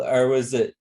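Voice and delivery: usual speaking voice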